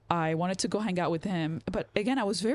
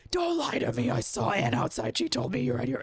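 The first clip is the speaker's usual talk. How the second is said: imitated furious dad, no real volume; mock angry scream; 6 semitones higher than usual. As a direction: scold like an angry father, without shouting. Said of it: "he's screaming so loud".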